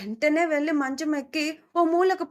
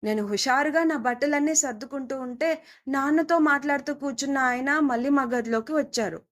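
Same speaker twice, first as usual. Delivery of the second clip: usual speaking voice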